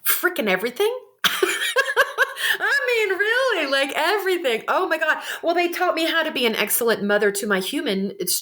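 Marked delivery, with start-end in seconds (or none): 1.2-2.6 high breathy laugh. Real, not acted. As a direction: burst of high airy laughter. Laughs at own answer.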